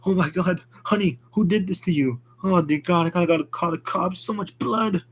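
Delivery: high, rushing panicked voice; finding her dead and falling apart; panicked grief. Acted. Husband discovering his murdered wife, high panicked cries.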